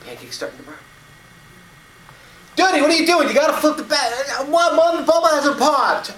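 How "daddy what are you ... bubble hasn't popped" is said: put-on much higher, louder frantic child's voice; boy protesting to his mother, high and frantic; panicked little boy. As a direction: blurt it out in a flustered kid panic. Boy panics at burning pancake.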